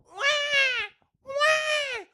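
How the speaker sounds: very high-pitched "wah" cries; imitated baby wail. Babies once the plane takes off, imitated wailing.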